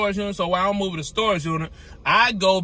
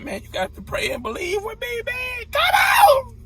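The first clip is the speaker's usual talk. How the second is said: desperate plea. Retold or imitated. Begging friend to pray.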